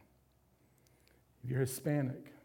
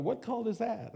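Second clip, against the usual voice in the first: high, shocked mock question; a mother horrified at her son's new church; alarmed mother; 8 semitones higher than usual. Mom hearing of the Wesleyans, alarmed high question. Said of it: "She was like".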